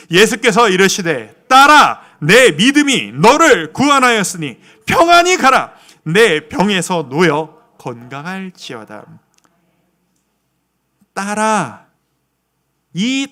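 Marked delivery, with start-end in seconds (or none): 0.1-9.2 luid en plechtig de bijbeltekst voorlezen; samen hardop voorlezen. Real, not acted. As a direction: lees het vers luid met de gemeente mee. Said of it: "삼십사 절, 시작".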